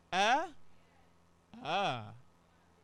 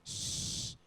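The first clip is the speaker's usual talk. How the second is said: an unvoiced shh to quiet the room; hushing the class; 6 dB quieter than usual. Real, not acted. Teacher hushes a noisy class, unvoiced shh. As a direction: hush them with a long shh. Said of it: "Now listen to me".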